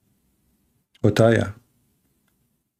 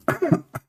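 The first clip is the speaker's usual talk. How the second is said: short high-pitched giggle; laugh; 5 dB quieter than usual. Real, not acted. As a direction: break into a brief, soft high laugh. Amused by his own mimicry.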